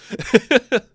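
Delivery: high rising laugh. Real, not acted. Vlogger laughing at the joke, high laugh. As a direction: laugh at the punchline.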